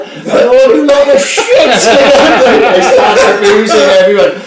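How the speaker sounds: high, loud, slow laughing; laughing hard. Real, not acted. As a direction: laugh through the words. Laughing while retelling gloat.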